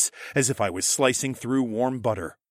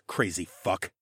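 muttered aside; 5 semitones lower, 5 dB quieter than usual. Acted.

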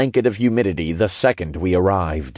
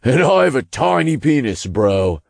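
higher, sarcastic jab; raised, jeering imp voice; joking; 5 semitones higher than usual. A narrator's reading. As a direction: toss out a mocking joke. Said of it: "Todd joked".

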